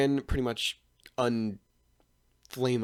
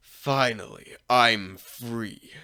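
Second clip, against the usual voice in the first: lightly voiced deep Reaper, drawn out; 5 dB louder, much slower than usual. The Reaper taking over Naruto's body, in a deep voice. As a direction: savor the release in a put-on deep voice. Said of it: "Naruto starts talking in a deep voice".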